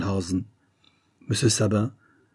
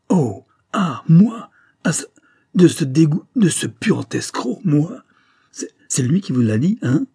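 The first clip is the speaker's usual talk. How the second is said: beside yourself, high, loud, gasping fragments; spluttering fury; 7 semitones higher, 5 dB louder than usual. A narrator's reading. Former lawyer just accused of murder, spluttering fury. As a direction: stammer and hiccup with outraged disbelief. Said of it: "Il bafouillait, il hoquetait".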